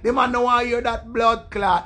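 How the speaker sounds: normal talking voice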